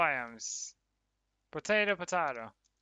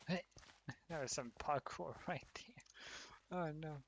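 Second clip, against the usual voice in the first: whisper; 11 dB quieter than usual. Real, not acted. Quiet aside while playing.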